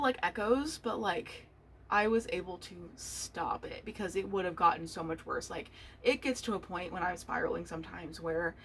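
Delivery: ordinary delivery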